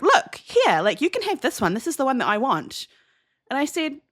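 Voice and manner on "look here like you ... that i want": exasperated mum. Retold or imitated. Mum's exasperated complaint acted out.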